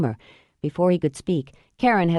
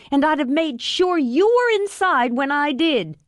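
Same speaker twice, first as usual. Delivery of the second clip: high-pitched spiteful woman's voice; Karen, defiant; 10 semitones higher than usual. Acted. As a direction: throw it at him, high and venomous. Karen's defiant tirade at Noah.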